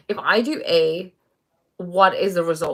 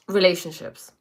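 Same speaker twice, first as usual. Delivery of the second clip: ordinary talk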